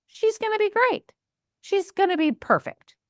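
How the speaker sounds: picture the perfect hire, beaming; bright-eyed hope about a new employee; hopeful. Retold or imitated.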